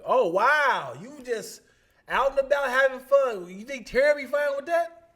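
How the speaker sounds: high, loud mock scolding of Glenn; mock confrontation. Friend catching Glenn out with another woman, high loud mock scolding. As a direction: catch him out in a shrill put-on voice. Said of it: "was like".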